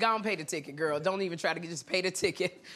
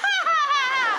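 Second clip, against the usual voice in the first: piercingly high, loud forced giggle; over-the-top high ha-ha-ha; louder fake laugh; 14 semitones higher, 5 dB louder than usual. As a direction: push the flirty laugh hard so it can't be missed. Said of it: "Maybe I didn't do it loud enough".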